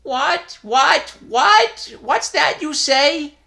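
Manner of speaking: high, loud repeated what, rising; flustered disbelief. A narrator's reading. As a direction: sputter in alarmed disbelief.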